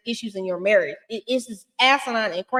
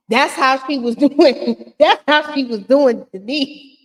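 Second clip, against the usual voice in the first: louder, slower, higher through laughter; amused, laughing; 4 semitones higher, 5 dB louder, slower than usual. Real, not acted. Explaining how she was doing, loud and laughing. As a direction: crack up at your own impression while explaining it. Laughing at her own imitation.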